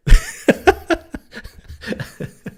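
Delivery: a sudden high, airy burst of laughter; real cackling laugh; high breathy laugh. Real, not acted. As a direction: laugh out loud at your own line. Laughs at own rhyme.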